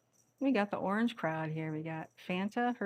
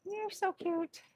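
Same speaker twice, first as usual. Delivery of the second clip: high cooing; 9 semitones higher than usual. Real, not acted. Owner cooing at her cat, high pitched. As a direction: coo it up high at the kitten.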